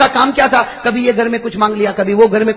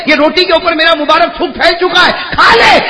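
high loud sermon shouting; fervent sermon shout; preaching at full force; 8 semitones higher, 3 dB louder than usual. Acted. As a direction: rouse the crowd. Preacher climax.

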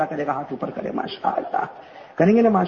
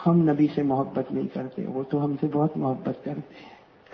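low, slow preaching; solemn devotional appeal; 3 semitones lower, 4 dB quieter, slower than usual. Acted. Preacher reproaching the congregation about love for the Prophet, solemn. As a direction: let it sink in, slowly and softening.

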